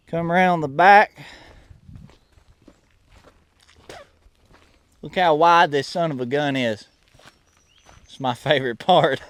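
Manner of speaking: put-on proud good-old-boy with a Southern accent. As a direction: brag on the truck in a Southern accent.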